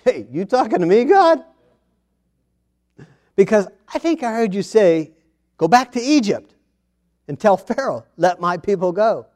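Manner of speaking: high-pitched, disbelieving reply; acting out a baffled response to God; incredulous Moses. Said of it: "And Moses' response".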